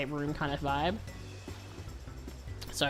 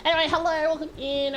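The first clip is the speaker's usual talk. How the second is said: cute anime streamer; 10 semitones higher, 3 dB louder than usual. Real, not acted. Greeting an incoming raid.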